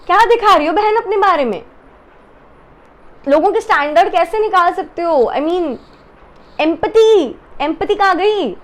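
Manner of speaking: high-pitched, exasperated questioning; indignant scolding. Real, not acted. Reviewer scolding contestant for lacking empathy, exasperated and high.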